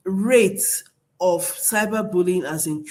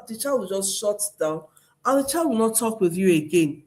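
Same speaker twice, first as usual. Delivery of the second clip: ordinary talk, nothing marked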